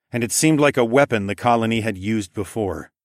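normal talking voice

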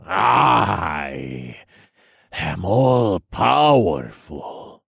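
slow, shouted megalomania; drawn-out, deranged boast; crazed villain. A narrator's reading. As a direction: proclaim it like a mad god. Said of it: "I could see the craziness in them".

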